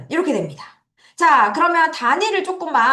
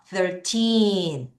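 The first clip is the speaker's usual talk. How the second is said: put-on Thirteen with a long, strong TEEN; thir-TEEEN, ending held; stress on TEEN. Shown as a demonstration. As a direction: stretch the second syllable of Thirteen. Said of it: "강하게 읽어주셔야 돼요".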